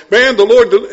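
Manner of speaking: loud and high, bursting out; excited testimony shout. Retold or imitated. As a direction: start the praise at full volume.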